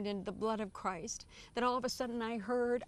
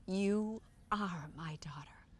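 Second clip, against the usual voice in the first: slow, breathy whisper of a loving mother; slow whisper from the Virgin to her daughter; tender whisper; slower than usual. Retold or imitated. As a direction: hush it out like a gentle blessing. Our Lady's words retold, tender.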